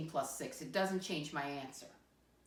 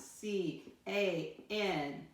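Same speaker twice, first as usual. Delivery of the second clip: slow spelling; much slower than usual. Shown as a demonstration. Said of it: "It's spelled like it sounds".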